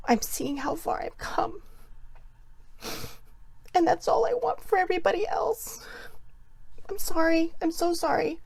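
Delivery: breaking into real tears, voice high; choked, tearful whisper that cracks upward; crying, emotional. Real, not acted. To her live viewers, overwhelmed by how far she's come, crying. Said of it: "I am sorry for getting emotional".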